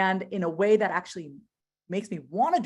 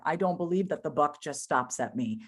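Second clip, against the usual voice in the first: ordinary talk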